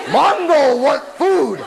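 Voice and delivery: slow, hungry caveman bellow; drawn-out Mongo-style roar; primitive brute. Acted. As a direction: demand it like a dim hungry brute.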